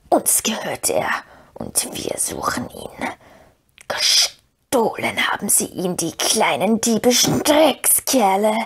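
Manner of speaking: slow, hate-filled creature whisper; Gollum, sharper hissing. A narrator's reading. Gollum curses the thieves of his treasure, sharp hissing whisper. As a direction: hiss bitterly after your stolen treasure, stretching the words. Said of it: "das Zischeln wurde schärfer und deutlicher".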